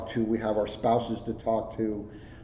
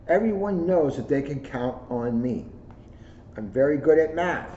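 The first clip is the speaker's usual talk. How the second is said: put-on bright, lively storybook read in a child's voice; reading to children; 5 semitones higher, 3 dB louder than usual. A narrator's reading. Officer reading a picture book to schoolkids, lively storybook voice. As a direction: read it like a proud kid telling his story. Reads kids' book aloud.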